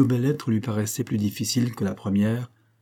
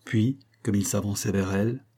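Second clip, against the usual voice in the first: usual speaking voice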